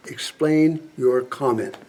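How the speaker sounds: slow and deliberate, word by word; slow, firm demand. Acted. General pressing a senator for an answer, slow firm demand. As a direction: spell out the demand with controlled patience.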